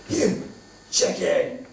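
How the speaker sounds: a high yell down the road; shouting after. Retold or imitated. Stranger yells after him.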